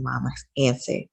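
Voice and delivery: usual speaking voice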